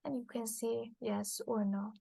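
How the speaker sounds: normal talking voice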